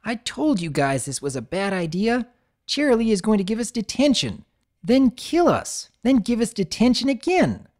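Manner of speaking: filly scolding her friend; barking complaint. A narrator's reading. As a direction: snap it at them, exasperated. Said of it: "barked up at Apple Bloom".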